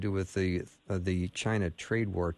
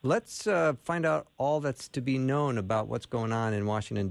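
ordinary talk, nothing marked

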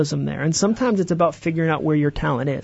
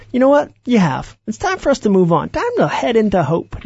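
a bright, high, louder burst of cheerleading; mock encouraging voice; 6 semitones higher, 4 dB louder than usual. Speaker voicing fear as an unlikely cheerleader, mock encouraging. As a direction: pretend fear suddenly cheers you on.

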